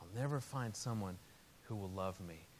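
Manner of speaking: put-on softly repeated sad child's line; tearful child, retold. Quoting his five-year-old little girl, understated tearful sadness. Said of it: "just big, big tears".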